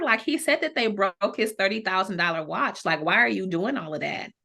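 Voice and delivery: ordinary talk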